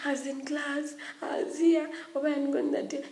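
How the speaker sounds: high, pleading excuse-making; lightly voiced girls making excuses; mock excuses. Girls making up excuses to the teachers, mock pleading. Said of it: "People start making up, like, excuses".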